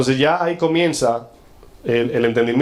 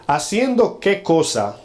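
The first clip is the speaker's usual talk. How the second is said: slow, higher-pitched, mildly haughty quote; haughty question; 6 semitones higher, slower than usual. Acted. Scribe questioning Jesus about eternal life, mildly haughty. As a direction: draw out the question with mock self-importance. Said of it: "de altivez".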